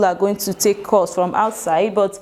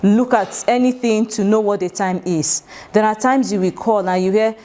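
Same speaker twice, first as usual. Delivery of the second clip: usual speaking voice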